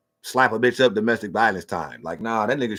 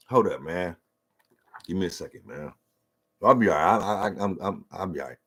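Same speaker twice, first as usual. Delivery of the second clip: real emotion, softer, pulling yourself together; choked up; 4 semitones lower, 4 dB quieter than usual. Real, not acted. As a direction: fight back tears and steady your voice. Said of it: "I get emotional talking about this".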